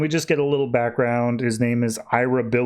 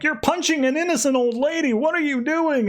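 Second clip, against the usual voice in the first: shocked scolding; 14 semitones higher than usual. Retold or imitated. Barbara scolding Tim.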